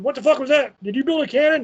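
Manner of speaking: lightly voiced accusing wife; husband mimicking his wife catching him out; imitating the wife. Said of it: "And my wife's gonna come out and".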